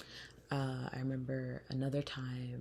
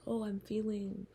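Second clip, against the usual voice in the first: higher, pained retelling of her own words; 8 semitones higher than usual. Retold or imitated. Retelling to viewers what she told her therapist, higher and pained. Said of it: "I would say, like".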